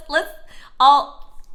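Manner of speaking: ordinary delivery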